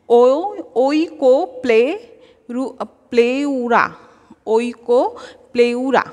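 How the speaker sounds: ওইকোপ্লেউরা broken into slow syllables; slow pronunciation. Shown as a demonstration. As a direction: sound out ওইকোপ্লেউরা piece by piece. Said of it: "এইভাবে উচ্চারণ করে করে পড়লে".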